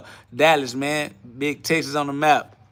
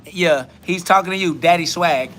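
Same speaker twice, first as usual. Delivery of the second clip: ordinary delivery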